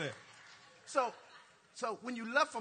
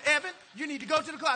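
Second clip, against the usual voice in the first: louder final warning; 5 semitones higher, 9 dB louder, faster than usual. Retold or imitated. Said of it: "he said it one more time".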